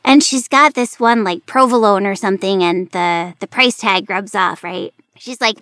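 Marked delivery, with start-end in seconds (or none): none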